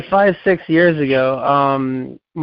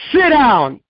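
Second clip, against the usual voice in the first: sharp high command, retold judge; snapped judge's order; stern order; 9 semitones higher, 4 dB louder than usual.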